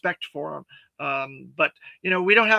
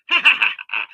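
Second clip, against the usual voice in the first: villain laugh; 9 semitones higher, 5 dB louder than usual. Pretend. Villain laugh after in-character shout.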